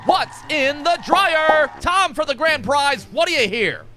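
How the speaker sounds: bright, high mock host patter; game show host. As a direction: pitch it like a TV quiz show.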